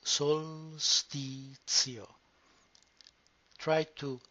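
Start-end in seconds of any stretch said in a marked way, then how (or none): 0.0-2.1 very slow "Solstizio", each consonant and vowel stressed; slow, every sound clear. Shown as a demonstration. Teacher sounding out "Solstizio" for learners. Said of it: "emphasize the sound of each consonant and vowel".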